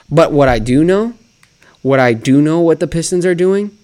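ordinary talk, nothing marked

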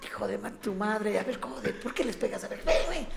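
amenaza actuada, mucho más aguda; mock furious threat. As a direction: hace como que se lanza a pelear, exagerado. Acting threat while held back. (el actor retenido por compañeros, amenaza fingida y aguda)

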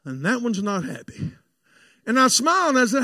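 ordinary delivery

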